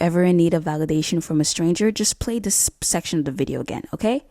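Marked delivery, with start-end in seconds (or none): none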